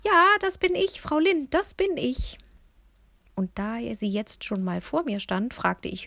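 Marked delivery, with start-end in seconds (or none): 0.0-2.4 put-on high, loud old-woman voice; cheerful muddled old lady mishearing; much higher, louder elderly voice; confused old lady. A narrator's reading.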